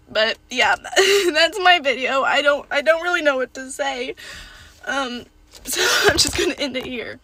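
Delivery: tearful words with a laugh breaking through; crying while talking. Real, not acted. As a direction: talk through real tears. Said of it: "Gosh, I'm, like, bawling".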